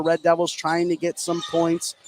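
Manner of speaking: normal talking voice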